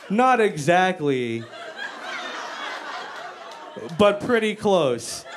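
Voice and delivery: slow, drawn-out careful correction; awkward hedging. Retold or imitated. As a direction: stretch it out reluctantly.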